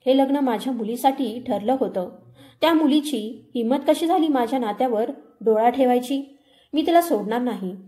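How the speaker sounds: plain reading voice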